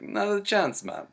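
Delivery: hammed-up Jamaican refusal; Rasta guy. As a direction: brush him off like a chill Rasta.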